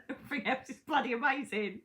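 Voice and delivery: real amusement breaking into the words; laughing. Real, not acted. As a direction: laugh your way through the line. Laughs at her own joke about men.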